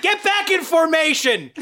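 loud drill sergeant shout; barked order. Acted.

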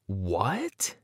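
soft, stunned question; quietly dumbfounded; open-mouthed shock. A narrator's reading. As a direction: breathe it out in disbelief, rising. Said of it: "My mouth fell open".

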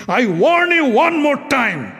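sharp, loud warning; angry king's warning. Acted. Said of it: "Virat was furious".

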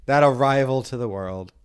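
mock solemn announcement. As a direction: proclaim it like a miracle.

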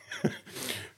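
short airy chuckle; soft breathless chuckle; breathy laugh. Real, not acted. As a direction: huff out a quiet laugh. Laugh alone.

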